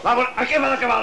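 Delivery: high, rapid indignant retort; exasperated. Acted. Superior fends off a prisoner's absurd question, exasperated. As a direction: rush it out in high-pitched annoyance.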